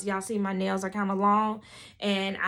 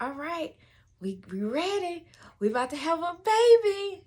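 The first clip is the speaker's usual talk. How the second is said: upbeat rallying, rising higher; good energy; 8 semitones higher, 4 dB louder than usual. Retold or imitated. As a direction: build from ready to a high, loud "baby". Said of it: "keep my energy good".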